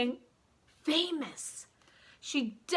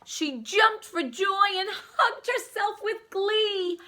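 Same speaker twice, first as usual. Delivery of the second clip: gleeful; 9 semitones higher, 4 dB louder, slower than usual. A narrator's reading. Joyful narration with laughter.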